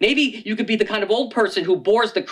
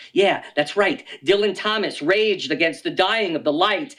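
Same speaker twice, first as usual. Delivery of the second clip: ordinary talk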